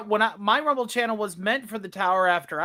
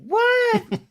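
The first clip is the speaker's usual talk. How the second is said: high incredulous gasp; can't believe what you heard; shocked what. Real, not acted. Disbelief at hating peanut butter.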